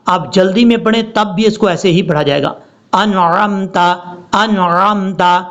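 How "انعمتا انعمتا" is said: slow joined 'انعمتا', audible ع; correct clear ain. Shown as a demonstration. Teacher shows it still holds, careful correct recitation. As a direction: keep the throat squeeze in 'انعمتا'. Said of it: "یہ ہے اس کا پڑھنے کا طریقہ".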